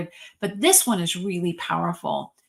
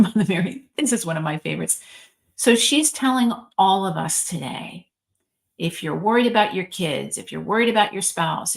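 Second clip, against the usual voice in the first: usual speaking voice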